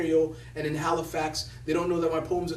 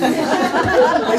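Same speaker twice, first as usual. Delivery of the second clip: loud laugh; 12 semitones higher, 12 dB louder than usual. Real, not acted. Laughing at having to say thank you. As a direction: burst of high laughter.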